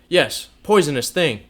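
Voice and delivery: loud, raging insult; enraged shout at her; passionate fury. A narrator's reading. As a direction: spit it out beside yourself. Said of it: "beside himself with passion".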